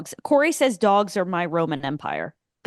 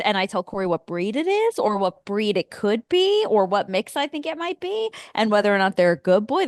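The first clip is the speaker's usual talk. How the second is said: normal talking voice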